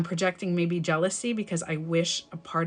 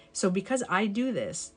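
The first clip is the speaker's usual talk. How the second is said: ordinary delivery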